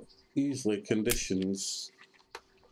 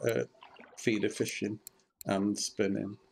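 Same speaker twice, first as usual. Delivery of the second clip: usual speaking voice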